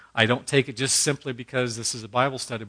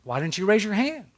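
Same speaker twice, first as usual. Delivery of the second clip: higher, kindly Georgia preacher asking; Georgia accent; 9 semitones higher than usual. Retold or imitated. Preacher coaxing the one little boy, in a higher Georgia accent. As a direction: imitate the old preacher's Georgia lilt, pitched up. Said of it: "with that thick Georgia accent".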